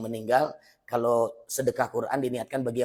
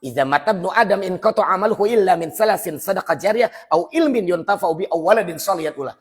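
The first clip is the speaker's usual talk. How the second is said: loud, fast Arabic recitation, higher pitch; fiery preacher reciting Arabic; hadith recitation; 8 semitones higher, 11 dB louder than usual. Acted. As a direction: declaim the hadith at full force.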